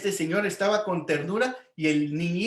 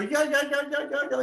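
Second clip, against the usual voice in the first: put-on high, bright eager little boy calling yo yo yo; a small boy eager to go first; eager kid volunteering; 9 semitones higher than usual. Little boy volunteers to go first, high and eager.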